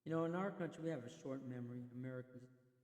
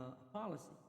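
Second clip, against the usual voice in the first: ordinary delivery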